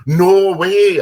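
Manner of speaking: loud, high "no way" bursting out; excited disbelief. Retold or imitated. Drunk from Minnesota finds a fellow local, loud and high. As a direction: blurt it out, thrilled. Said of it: "And he goes".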